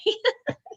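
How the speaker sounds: short high real laugh; a sudden laugh breaking out; burst of laughter. Real, not acted. Laughs at son's honesty.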